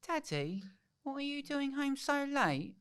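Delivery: put-on high, slow little kid voice; child voice. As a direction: play a small child asking dad. Kids ask dad why late.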